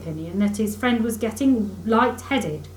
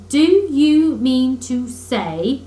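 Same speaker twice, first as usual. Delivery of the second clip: very slow, louder drawn out; excited; 4 semitones higher, 8 dB louder, much slower than usual. A narrator's reading. Excited Rat pressing Mole about the doormat. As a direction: stretch each word in excitement. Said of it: "cried the excited Rat".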